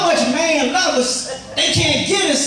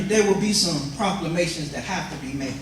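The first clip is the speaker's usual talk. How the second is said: lower, softer, with bass in the voice; with some bass; 7 semitones lower, 6 dB quieter than usual. Shown as a demonstration. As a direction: drop the voice down low and steady. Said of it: "Say it with some bass in your voice".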